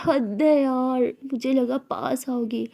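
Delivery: drawn-out, disappointed sigh of words; slow pouting complaint; slow sulky complaint. Acted. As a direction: stretch it out, sulking.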